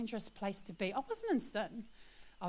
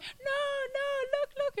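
put-on kids calling out; 4 dB louder than usual. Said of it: "the kids are like".

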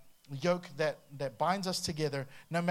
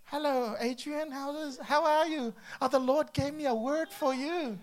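high, slow, gushing friend; overly cheerful visitor; 9 semitones higher, 4 dB louder than usual. Retold or imitated. Early friend greeting sleepy host, overly cheerful. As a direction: gush like a pushy morning visitor. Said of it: "friend comes in, knocks".

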